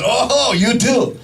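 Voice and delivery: put-on long, drawn-out Japanese-accented "oh"; mock Japanese doctor, slowly delighted at the coincidence; imitated Japanese accent. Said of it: "this is how he spoke".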